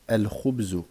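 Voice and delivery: "Al-khubzu" with a clear L before kh; lam pronounced. Shown as a demonstration. Teacher proving the rule for learners, lam pronounced. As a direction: sound the article's L in "Al-khubzu". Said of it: "on prononce le lem".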